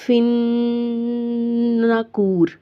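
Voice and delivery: फिन्नकूर recited slowly with a long sustained n; lengthened nasal. Shown as a demonstration. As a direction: draw out the न in फिन्नकूर in a long hum. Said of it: "इसको थोड़ा लंबा कर देना है".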